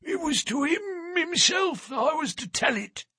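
put-on a frail old sailor at the door; old sailor's voice. A narrator's reading. Old sailor asks for Holmes at the door, frail and high.

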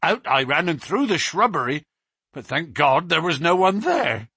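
the policeman reliving the face at the window; terrified constable. Acted. Constable Walters telling Baines and Holmes of the beast, high and terrified.

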